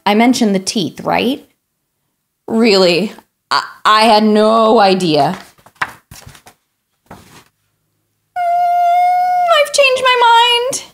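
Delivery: lightly voiced pushy pigeon, pleading and dramatic; a hint of a whiny, excitable bird; pigeon character. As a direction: play the eager pigeon begging for a puppy. Reader voices the pigeon throughout.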